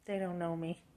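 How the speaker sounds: softly to herself; hushed inner voice; quiet self-talk. Retold or imitated. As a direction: say it under your breath. Self-talk after criticism.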